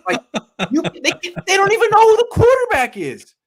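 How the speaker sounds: high, loud laugh through the words; incredulous laughing rant; laughing disbelief. Real, not acted. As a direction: crack up at how absurd it is.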